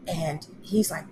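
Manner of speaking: ordinary delivery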